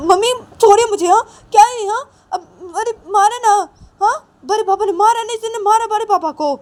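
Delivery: put-on very high, fast, loud tearful boy; crying child. Ravi's son cries to his mummy, high and fast. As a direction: sob out the complaint.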